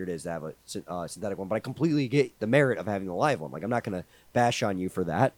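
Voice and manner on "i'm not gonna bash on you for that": ordinary talk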